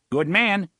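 high, loud smart Nordite reply; smart reply in accent. Acted. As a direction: snap it back smartly in the picket's accent. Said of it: "the blond returned smartly".

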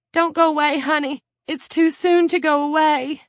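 high, loud distraught plea; tearful pleading. A narrator's reading. Claudia begging her cellmate not to go, tearful. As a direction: beg as if starting to cry. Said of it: "Claudia began to cry".

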